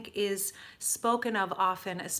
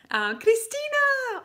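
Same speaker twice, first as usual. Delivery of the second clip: high, slow, loud call of a name; excited greeting; 8 dB louder, slower than usual. Real, not acted. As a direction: call out her name with delight.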